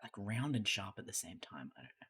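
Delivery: ordinary talk, nothing marked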